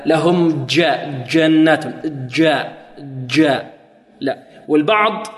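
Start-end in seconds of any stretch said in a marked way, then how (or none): 0.0-3.7 جاء said with a jeem drawn toward dal; slow error demo on جنات and جاء. Retold or imitated. Teacher imitates students' dal-tinged jeem. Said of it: "هكذا".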